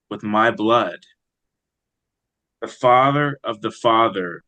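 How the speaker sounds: slow, measured poetry recital; poem reading. Acted. Poet reading his own poem for the host, slow and measured. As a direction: read each line slowly and let it settle.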